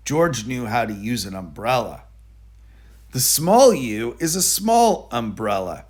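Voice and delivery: usual speaking voice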